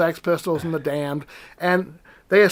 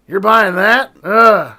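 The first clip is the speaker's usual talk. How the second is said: sneering "you're buying that", then a disgusted ugh; mock the customer's record choice; scornful clerk; 3 semitones higher, 10 dB louder, much slower than usual. Record clerk scorning a customer's purchase, sneering. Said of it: "he'd like".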